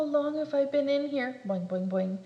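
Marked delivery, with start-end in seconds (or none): none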